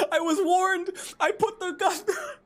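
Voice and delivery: very high, squealed through laughter; helpless high-pitched laughing excuse; laughing protest. Real, not acted. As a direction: plead your case while cracking up. Laughing apology after game mistake.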